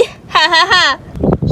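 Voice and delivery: gloating villain cackles; breathy gloating cackle; evil laughter. Acted. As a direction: cackle together.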